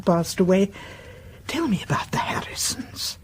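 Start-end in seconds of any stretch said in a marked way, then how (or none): 1.5-3.2 soft, eager whisper; gently whispered request for gossip; coaxing whisper. Acted. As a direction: whisper it to draw the story out. Coaxing a secret out.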